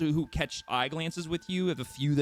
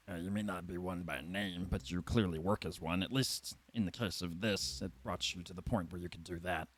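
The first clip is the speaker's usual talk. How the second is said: low, soft, smooth-talking gnome; a low sly gnome pitching a job; gnome recruiter voice; 6 semitones lower, 7 dB quieter than usual. Acted. Gnome recruiter coaxing the party, low and soft.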